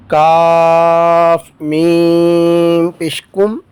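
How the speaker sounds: drawn-out, chanted letter-by-letter spelling; slow spelling recitation. Shown as a demonstration. Teacher slowly chanting the spelling of each word for students. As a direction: stretch each letter name like a Quran teacher.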